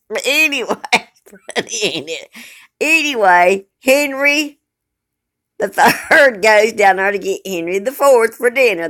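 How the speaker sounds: fighting off the giggles to go on; still laughing. Real, not acted. Tries to continue, still laughing.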